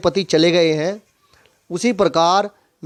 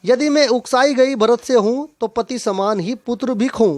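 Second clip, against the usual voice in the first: higher-pitched rhythmic poem line; chant-like reading of a couplet; verse recitation; 6 semitones higher than usual. Acted. Recites poem verse before explaining it.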